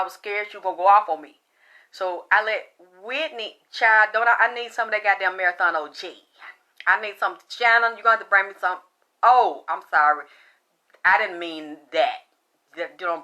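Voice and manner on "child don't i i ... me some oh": normal talking voice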